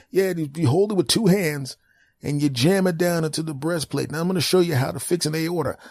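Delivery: high, chirpy doctor voice; high cheerful doctor asking questions; high mock doctor. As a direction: breezily quiz the caller.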